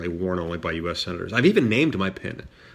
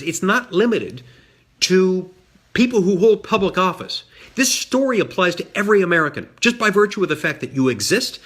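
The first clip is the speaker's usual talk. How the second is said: higher, louder rallying speech voice; rousing oratory; 10 semitones higher, 5 dB louder than usual. Real, not acted. Senator closing his speech to the audience, rousing oratory. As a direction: build it like a stump speech climax.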